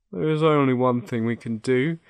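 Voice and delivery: high-pitched lion voice. Acted. The Lion answers Dorothy's question, in character. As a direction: lift it into the lightly acted Lion.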